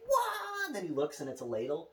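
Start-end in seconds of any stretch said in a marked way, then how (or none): 0.1-0.7 high startled yelp. Retold or imitated. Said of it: "he's like".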